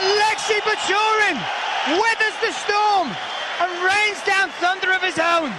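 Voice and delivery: dramatic commentator call. Real, not acted. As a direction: sell the comeback big. (драматичный комментарий)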